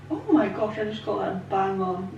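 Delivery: ordinary talk, nothing marked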